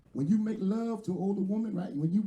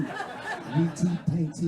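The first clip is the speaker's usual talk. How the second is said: slow mock sounding-out; much slower than usual. Comedian mocking his girlfriend's misreading, slow and laughing. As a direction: drag each letter out slowly, laughing. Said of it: "What the hell is wrong with you?".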